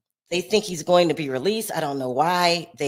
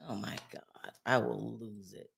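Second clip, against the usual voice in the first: low, soft muttering to oneself; hushed dismay; 10 semitones lower, 14 dB quieter than usual. Real, not acted. Host checking who is live, hushed dismay. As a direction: drop pitch and volume, stunned.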